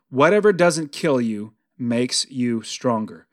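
ordinary talk

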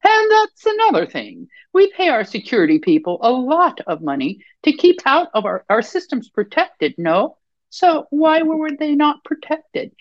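climbing pitch, getting worked up; rising voice. Acted. Board member pressing the wife, voice rising. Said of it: "her voice slightly raising".

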